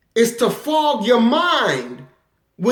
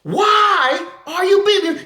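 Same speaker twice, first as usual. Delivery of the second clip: pleading victim; 7 semitones higher than usual. Retold or imitated. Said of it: "and she".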